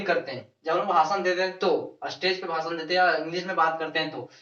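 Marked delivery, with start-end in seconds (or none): none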